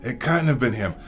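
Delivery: high, loud, agitated protest; Hagrid, ranting and raving. A narrator's reading. Hagrid swears to Dumbledore it wasn't Harry, ranting. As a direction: rant without letting anyone cut in. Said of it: "Hagrid went ranting and raving".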